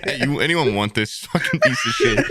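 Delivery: hammed-up disowning shout; mock calling out. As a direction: hawk it to anyone nearby. Acts out offering off his jacket.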